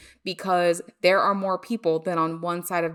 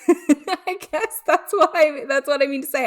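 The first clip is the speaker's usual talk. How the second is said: laughing; 9 semitones higher, 7 dB louder than usual. Real, not acted. Host awkwardly waving off unwanted listeners, laughing. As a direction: talk through a laugh, high and louder.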